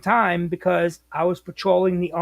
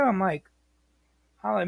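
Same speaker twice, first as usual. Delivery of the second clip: ordinary delivery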